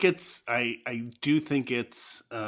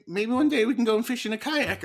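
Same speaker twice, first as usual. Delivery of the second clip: put-on high, dreamy child suggestion; imitating son's high hopeful voice; high kid voice; 11 semitones higher, 5 dB louder than usual. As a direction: wish aloud like a little boy. Child voiced in retold story.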